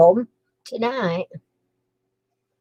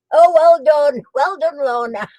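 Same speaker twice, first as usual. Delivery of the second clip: delighted praise; 4 semitones higher, 7 dB louder than usual. Real, not acted. Praising a finished puzzle.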